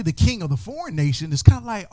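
ordinary talk, nothing marked

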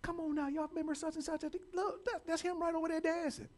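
high, amazed exclamations; amazed crowd. Retold or imitated. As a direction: gasp like a stunned crowd. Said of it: "They're just like".

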